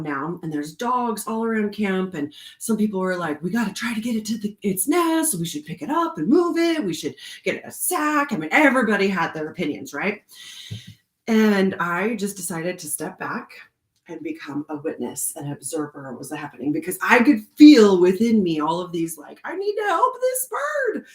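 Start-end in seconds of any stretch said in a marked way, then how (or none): 3.4-8.3 high-pitched imitation of bystanders; mock urgent suggestions from a crowd; fussing onlookers. 19.4-21.1 very high, pleading inner cry; pitched way up, mock-frantic; urgent rescuer impulse. Voicing inner urge to rescue.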